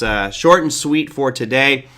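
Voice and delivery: usual speaking voice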